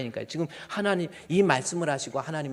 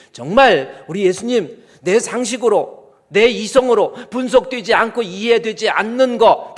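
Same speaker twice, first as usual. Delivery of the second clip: building to a loud, high climax; fiery preaching; 6 semitones higher, 13 dB louder than usual. Real, not acted. Pastor exhorting the congregation about true faith, loud and rising. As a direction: preach it with everything you have.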